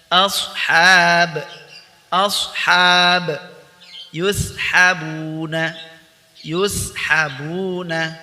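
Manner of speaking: loud, clear model recitation; recitation drill. Shown as a demonstration. Tahsin teacher modelling words for students, loud clear recitation. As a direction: recite each word loudly for students to echo.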